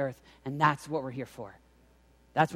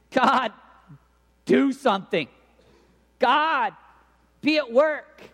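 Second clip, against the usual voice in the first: loud, higher plea in short bursts; urgent prayer cry; 7 semitones higher, 6 dB louder, slower than usual. Acted. Student at a campus prayer meeting, loud urgent plea. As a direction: cry out to God, pausing between pleas.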